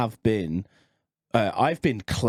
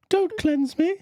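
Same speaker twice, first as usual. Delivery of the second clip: pleading, pitched way up; high squeaky phone voice; slower than usual. Pretend. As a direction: voice the phone begging.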